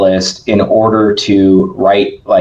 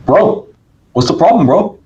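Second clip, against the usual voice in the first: a hint of a Brazilian accent, pitched higher; lightly imitating a Brazilian coach's accent, higher up; 8 semitones higher than usual. Roberto teasing the speaker in a mock Brazilian accent. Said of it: "he's got a thick Brazilian accent".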